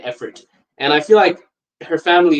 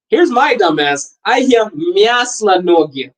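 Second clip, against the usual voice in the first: ordinary talk, nothing marked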